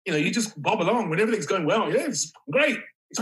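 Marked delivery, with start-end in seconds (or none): 1.9-2.8 breezy carefree. Retold or imitated. Carefree voice when things go well.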